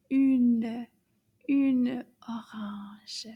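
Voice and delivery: une stretched slowly, une orange drawn out; French une orange. Shown as a demonstration. Teacher to children, une orange slowly to copy. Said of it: "So can you say that with me?".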